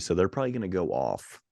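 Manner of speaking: ordinary talk, nothing marked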